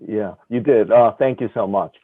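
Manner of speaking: ordinary delivery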